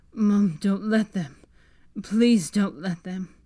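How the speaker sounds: loud, slow, desperate plea; desperate begging. Acted. Delirious boy begs mum, loud slow plea. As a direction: beg mother not to let them.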